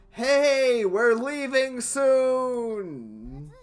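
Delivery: calling out. Acted. Calling out at the boat.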